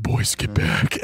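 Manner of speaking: slow, high, breathy warning; urgent whisper. Retold or imitated. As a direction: hiss it as a warning, slowly. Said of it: "He's like".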